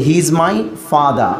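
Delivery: slow, drop the r: "father" as fad-a; British non-rhotic father. Shown as a demonstration. Teacher demonstrating British R dropping to students. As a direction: say "He is my father" slowly with no r at the end. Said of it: "ফাদার বলবে না".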